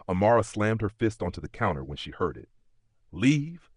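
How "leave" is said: higher, sharply rising disbelief; incredulous question. A narrator's reading. Amara reacting to the officers' mere leave, incredulous. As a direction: throw the word back as an indignant question. Amara indignant at hearing the news.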